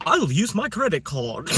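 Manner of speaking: slow, high mock British accent through laughter. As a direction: say it like a posh Brit, laughing. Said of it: "British accent".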